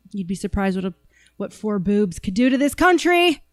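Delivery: ordinary delivery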